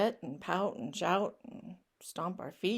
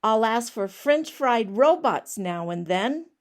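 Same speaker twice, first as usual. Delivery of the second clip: pigeon declaring it louder; insist, louder, on every word; loud indignant pigeon; 9 dB louder than usual. Acted. Pigeon lists his requests.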